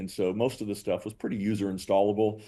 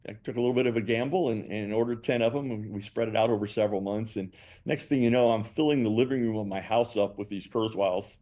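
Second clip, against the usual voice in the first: normal talking voice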